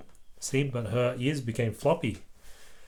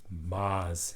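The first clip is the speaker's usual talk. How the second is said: much softer, lower Mars; whisper; 7 semitones lower, 9 dB quieter than usual. Acted. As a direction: breathe the word like a secret dream. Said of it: "he whispered".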